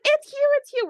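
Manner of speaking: high-pitched, gushing fan excitement; excited fans. Acted. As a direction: gush like fans spotting a favorite. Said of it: "We're all excited to see Hugh".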